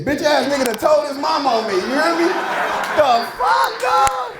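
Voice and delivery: father ranting about son telling mama, mock outraged yell. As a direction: rant at the kid who snitched.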